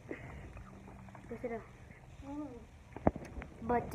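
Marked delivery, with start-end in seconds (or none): 0.1-1.6 whispering. Real, not acted. Whispered slow instruction aside.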